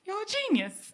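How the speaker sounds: high-pitched mock gush; squeaky-high retold compliment; gushing praise. Mimics three admiring readers.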